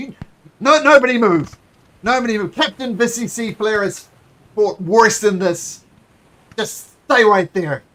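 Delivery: loud, panicky guard shouting orders; panicked guard. Acted. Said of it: "is panicking, but he's maintaining his post".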